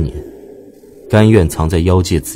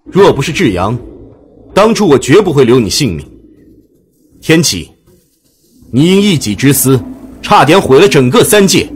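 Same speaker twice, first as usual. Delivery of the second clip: angry accusation; 5 semitones higher than usual. Acted. Baijue accusing Tianqi in anger.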